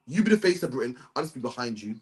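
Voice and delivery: dropping low and soft to step back; mock deference. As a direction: imitate someone meekly yielding. Voicing a man content behind others.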